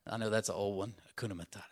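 quiet throwaway repeat; muttered aside. Real, not acted. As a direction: mumble it to yourself.

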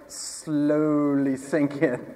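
very slow, stretched-out words; drawn-out slowly. Acted. Preacher joking about sinking in water, drawn out slowly. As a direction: draw out "slowly" like a gradual descent.

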